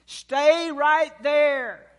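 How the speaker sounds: high, loud drawn-out call from above; re-enacted father's long-distance shout; retold yell. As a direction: holler slowly to a lost child far below. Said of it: "I yelled out".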